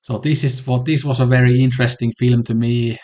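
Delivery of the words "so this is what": ordinary talk, nothing marked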